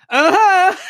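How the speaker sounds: high, loud hammed-up "uh-huh"; mock-enthusiastic high whoop of agreement; converted crowd member. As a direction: join in like a full convert. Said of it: "by day four, I'm like".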